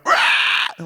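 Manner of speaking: breathy unvoiced rawr, next level; real roar. Shown as a demonstration. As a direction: roar it fierce and rasping. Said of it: "it's gotta be like".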